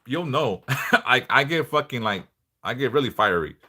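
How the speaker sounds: normal talking voice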